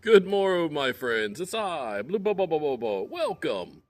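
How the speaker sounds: high, bright host greeting; storyteller persona. Acted. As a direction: greet the listeners as a merry storyteller.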